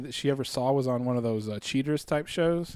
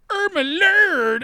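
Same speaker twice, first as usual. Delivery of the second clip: high, loud, drawn-out wail; slow, exaggerated mock-matron cry; Madea; 14 semitones higher, 6 dB louder, much slower than usual. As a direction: stretch it way out in a cartoonish high holler. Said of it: "shows up as Medea in South Park and starts just going".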